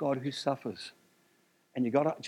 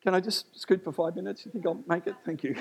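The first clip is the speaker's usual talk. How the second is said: usual speaking voice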